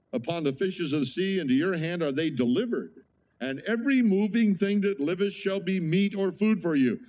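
rising, swelling King James recitation; scripture reading. Acted.

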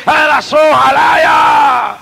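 slow high shouted tongues. Real, not acted. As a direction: cry out in tongues, drawn out. Glosolalia gritada. (lenguas gritadas, lentas y agudas)

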